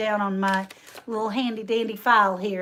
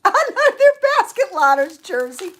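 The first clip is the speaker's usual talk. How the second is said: high and loud through a laugh; 8 semitones higher, 8 dB louder than usual. Real, not acted. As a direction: laugh while admitting the mistake. Laughing at her own mistake.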